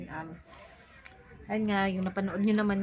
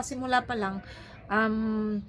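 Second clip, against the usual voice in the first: normal talking voice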